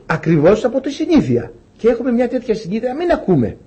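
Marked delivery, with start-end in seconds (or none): none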